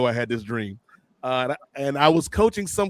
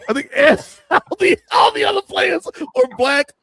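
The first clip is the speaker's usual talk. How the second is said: high laughing outburst, louder; laughing shout; excited laugh; 14 semitones higher, 4 dB louder than usual. Real, not acted. Excited storytelling.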